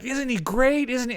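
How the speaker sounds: high, excited gushing; Michael Bay. Retold or imitated. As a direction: imitate a thrilled director seeking agreement. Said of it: "he was just bouncing off the walls".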